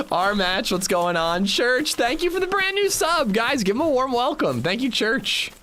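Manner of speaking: high-pitched hyped welcome; excited thanks. Real, not acted. Streamer thanking a new subscriber, excited.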